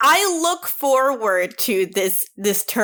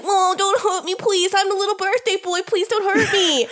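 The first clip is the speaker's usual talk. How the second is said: high, whimpering, begging crocodile man; sniveling little baby; 9 semitones higher than usual. Retold or imitated. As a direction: plead like a terrified little pet. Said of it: "like a sniveling little baby".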